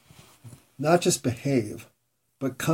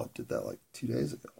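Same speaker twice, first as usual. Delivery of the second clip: hushed dawning realization; 12 semitones lower, 12 dB quieter, faster than usual. Retold or imitated. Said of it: "I'm like".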